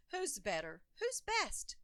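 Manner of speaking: plain reading voice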